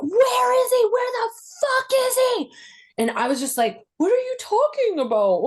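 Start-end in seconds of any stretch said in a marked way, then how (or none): 0.0-2.5 high, furious demand; enraged dad. Retold or imitated. Father tearing through the closet demanding where he is, enraged yell. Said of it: "this enraged, like, animal". 4.0-5.4 high, feigned innocence; playing innocent. Teen playing dumb to her raging father, high innocent voice.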